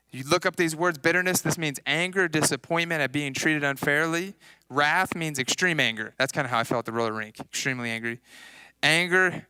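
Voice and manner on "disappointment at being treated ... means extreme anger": ordinary delivery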